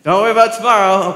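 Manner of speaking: loud, fast, mocking blurt of the verse; mock loud command. Pastor mocking Jesus' words as a joke, loud and fast. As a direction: bark the verse like a comic order. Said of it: "This is a funny guy".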